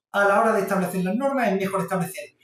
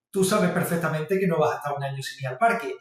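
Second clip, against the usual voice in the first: normal talking voice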